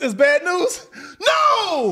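high, loud hammed-up wail; 1 mock wailing. Girlfriend hearing bad news on the call, mock wailing high and loud. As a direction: overact crying over bad news. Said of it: "acting out a phone call".

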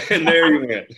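laughing through the repeat. Real, not acted. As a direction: break into a laugh as you repeat it. Friends joking about stealing lines.

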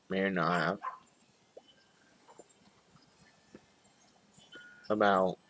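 muffled lips around stem; talking around pipe stem. Real, not acted. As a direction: pipe clenched between lips. Seen 0: Long pipe stem held between lips while speaking, removed by 3.0s.

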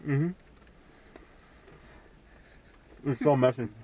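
muffled through fingers; talking while licking fingers; chewing with fingers at mouth. Real, not acted. Seen 3: Man holds fingertips at lips, jaw moving, while murmuring and speaking.

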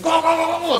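fast, high sideline coach yelling go; barking gym coach. Retold or imitated. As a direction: bark go go go like a fired-up coach. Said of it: "片野坂監督って".

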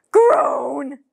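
big loud frustrated groan. Acted. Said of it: "kept getting yelled at".

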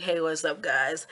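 low, deadpan mock hello; pretend low-key greeting. Vlogger guesses what viewers prefer, mock low-key greeting. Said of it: "I think you'd rather me be like".

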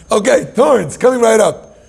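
high, loud, drawn-out compliance; eager angel servant. Acted. Angels answering God's demand for thorns, hammed-up eager voice. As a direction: sing out like an eager order-taker.

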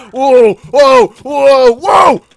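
startled, escalating whoas; alarmed shouting. Real, not acted. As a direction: yell as things fly at you. Objects fired at him.

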